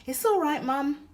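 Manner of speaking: put-on high, bright-eyed little boy soothing; reassuring child. Young son reassuring his tired mum, high and eager. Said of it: "he reassured her".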